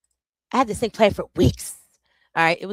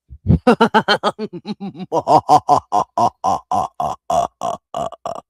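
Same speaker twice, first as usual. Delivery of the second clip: loud laugh; 5 semitones lower, 9 dB louder than usual. Real, not acted. Bursts out laughing at start.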